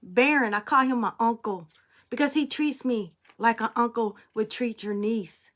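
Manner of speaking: usual speaking voice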